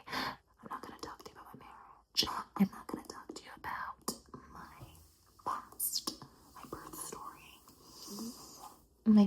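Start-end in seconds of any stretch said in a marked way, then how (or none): 0.1-7.6 very soft whisper, barely there; secretive whisper. Acted. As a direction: hush it like a secret nobody may hear. Secretive refusal in interrogation.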